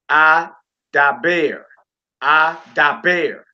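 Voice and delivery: "Adaber" slowly as ah-dah-BEAR; last-syllable stress. Shown as a demonstration. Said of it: "Accent on the last syllable".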